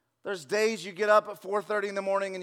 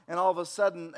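ordinary talk, nothing marked

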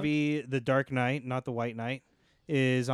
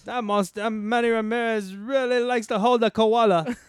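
high, loud, drawn-out mock Australian; Australian accent; 9 semitones higher, 6 dB louder than usual. Co-host riffing on Ramirez in Australia, mock Australian. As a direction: ham it up as an excited Australian. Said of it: "you know Australian".

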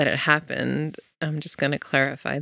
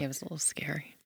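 hushed whisper; 15 dB quieter, faster than usual. Real, not acted. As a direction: say it under your breath. Hushed remark on scary event.

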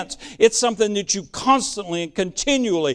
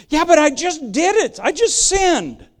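whiny objection; 7 semitones higher than usual. Acted. Voicing a guilty believer objecting.

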